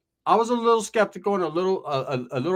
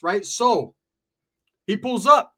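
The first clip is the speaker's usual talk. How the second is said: normal talking voice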